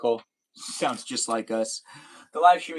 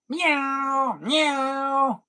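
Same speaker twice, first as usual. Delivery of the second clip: high meowing peacock cry; peacock call imitation; 11 semitones higher than usual. Shown as a demonstration. Streamer showing chat the peacock call, high meow. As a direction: copy a peacock's call, pitched way up. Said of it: "it's more like a meow".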